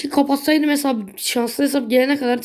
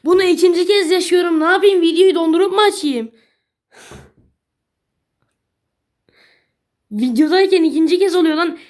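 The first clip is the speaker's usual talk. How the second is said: exasperated outburst; 7 semitones higher, 6 dB louder than usual. Real, not acted. Same bad pull happens again.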